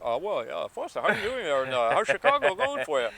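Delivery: smooth radio host voice. Pretend. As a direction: play it like a DJ on air. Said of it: "I could do my radio voice".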